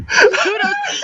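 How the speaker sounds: very high laughing word. Real, not acted. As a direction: say it through laughter. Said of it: "laughing too hard".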